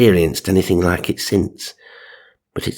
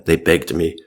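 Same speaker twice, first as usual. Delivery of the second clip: ordinary delivery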